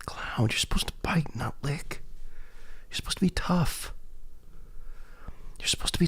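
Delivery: hushed unvoiced whisper to the cat. Real, not acted. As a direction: breathe it out softly, teasing the cat.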